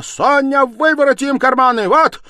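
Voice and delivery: screamed triumphant proof, very high; frantic shouting. A narrator's reading. Stepmother showing Sonya's empty pockets, frantic triumphant screaming. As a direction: shriek each point, stretching the last words. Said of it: "кричала Катерина Ивановна".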